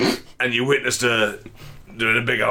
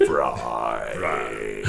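low, drawn-out creaky Cockney fry; vocal fry; 13 semitones lower, 5 dB quieter than usual. Shown as a demonstration. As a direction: let the word sink into a creak. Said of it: "classic Cockney vocal fry".

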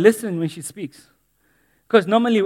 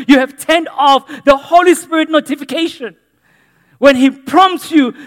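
louder, higher preaching over music; full-force preaching; 8 semitones higher, 9 dB louder than usual. Acted. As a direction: urge the church not to switch off.

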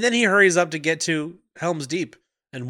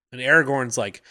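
ordinary talk, nothing marked

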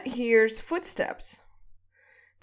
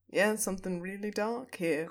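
Fred की आवाज़ की हल्की नकल; 4 semitones lower, 7 dB quieter than usual. Retold or imitated. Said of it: "Fred is just like".

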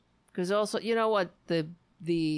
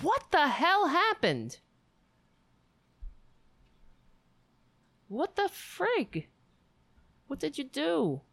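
shocked disbelief; 9 semitones higher than usual. Real, not acted. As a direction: gasp it out in disbelief. Reacting to Facebook jail news.

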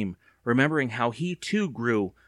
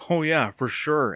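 slow, higher, mildly sarcastic retort; snapped; 5 semitones higher than usual. A narrator's reading. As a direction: drag it out scornfully. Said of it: "Evan snapped".

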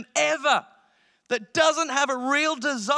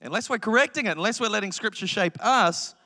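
ordinary delivery

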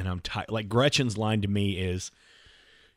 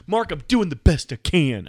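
higher, louder protest; imitated exasperated wife; 6 semitones higher, 7 dB louder than usual. Wife defending her messy car, higher and exasperated. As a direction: wail the defensive line at him. Acted wife's defensive catchphrase.

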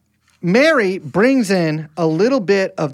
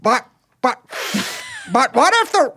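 put-on a failed try at a Boston accent; attempted Boston accent; 12 semitones higher than usual. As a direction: start the JFK line in a Boston accent, then break off. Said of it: "you have to do it in the accent".

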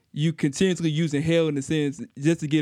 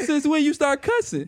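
high-pitched imitation of a shocked mother; mock motherly disbelief, pitched high; retold scolding mom; 12 semitones higher than usual. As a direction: imitate mom catching you cussing. Voicing shocked mother in story.

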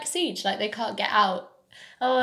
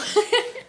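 high giggle; embarrassed giggle; 13 semitones higher, 4 dB louder than usual. Real, not acted. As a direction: giggle shyly. Embarrassed laugh after compliment.

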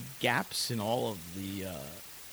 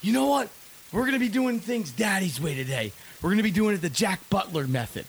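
loud, high dad taking charge; Michael Keaton impression; 7 semitones higher, 7 dB louder than usual. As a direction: boom it like a fed-up sitcom dad. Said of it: "And he goes".

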